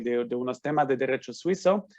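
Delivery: ordinary talk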